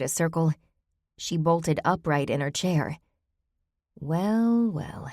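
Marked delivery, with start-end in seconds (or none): 4.0-4.7 high tittering witch voice; fairy tale witch. A narrator's reading. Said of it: "she tittered, sounding like a witch in a fairy tale". 4.7-5.1 soft falling witchy titter; fairy tale witch. A narrator's reading. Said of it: "she tittered, sounding like a witch in a fairy tale".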